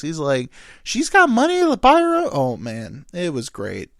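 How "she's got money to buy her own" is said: very high, loud exasperated retort; mock arguing. Buddy arguing back about his fries, hammed-up high yell. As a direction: act out an outraged comeback. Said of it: "they were arguing a back and forth".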